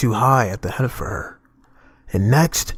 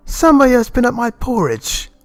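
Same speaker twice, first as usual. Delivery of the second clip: raised, higher middle-sized bear voice; a lightly voiced mother bear, higher; middle bear's middle voice; 10 semitones higher than usual. A narrator's reading. Narrator to listeners, voicing middle bear, higher. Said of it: "in her middle voice".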